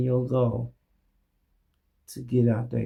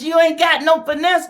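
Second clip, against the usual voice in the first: much higher and louder on ain't; mock him loudly; loud jeer; 13 semitones higher, 7 dB louder than usual. Real, not acted. Calling him out.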